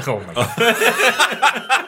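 breaking into laughter; laughing through the words. Real, not acted. Laughing at his own habit.

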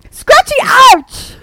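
high, drawn-out comic yell; gleeful shout. Acted. Chiropractor's pub name joke, gleeful high shout.

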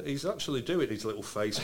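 ordinary talk

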